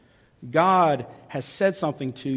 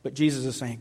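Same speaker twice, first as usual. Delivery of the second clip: ordinary talk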